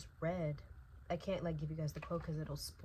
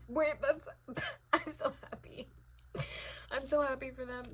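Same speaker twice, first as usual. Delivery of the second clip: giddy happiness; 7 semitones higher than usual. Real, not acted. Gushing about couple.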